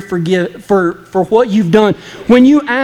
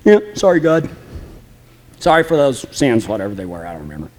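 careless mumbled apology; 11 semitones lower, 5 dB quieter, faster than usual. Retold or imitated. Careless mock prayer fading.

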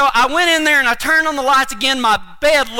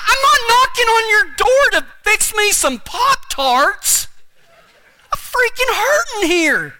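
high, drawn-out mock wail; hammed-up complaint, pitched way up; pained yelling; 12 semitones higher than usual. Retells pained late-night yelling at dad.

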